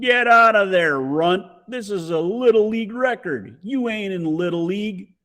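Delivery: high, loud sneering pitcher voice; croaking bully. A narrator's reading. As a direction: croak it down at the runt from the mound. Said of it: "McNabb croaked from the mound".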